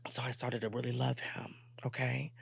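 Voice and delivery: hushed confessional whisper. Real, not acted. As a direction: drop to a whisper like a secret. Confession after loud build-up.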